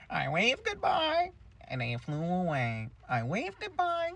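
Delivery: slow wail leaping very high on each goodbye; hammed-up unhinged teacher, stuck on repeat; crazed Miss Keen. Broken teacher repeating herself to the girls, slow high crazed wail. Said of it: "She just keeps repeating it".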